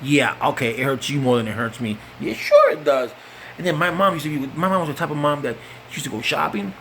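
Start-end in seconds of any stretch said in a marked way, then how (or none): none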